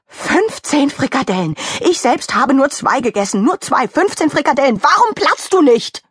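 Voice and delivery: high, outraged rant building to a shout; worked-up rage. Acted. Mickey Kuchen counting his fifteen meatballs, raging. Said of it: "hatte sich in Rage geredet".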